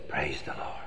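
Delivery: hushed praise. Acted. Boss hears his children died.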